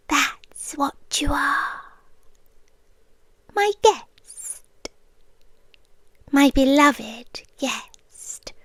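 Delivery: normal talking voice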